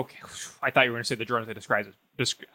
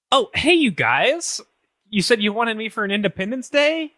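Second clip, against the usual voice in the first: surprised greeting; 7 semitones higher than usual. Acted. Popping up from under desk.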